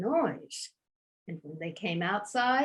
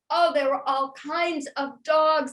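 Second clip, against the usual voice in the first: ordinary delivery, nothing marked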